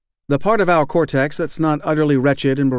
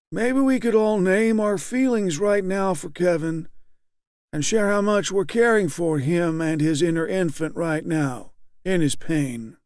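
high, blandly kind leader; 6 semitones higher than usual. A narrator's reading. Group leader gently affirms weeping Kevin, high and blandly kind. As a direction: soothe him like a patient therapist talking to a child. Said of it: "blandly kind, didactic quality as Rusk's".

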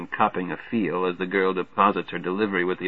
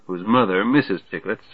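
plain reading voice

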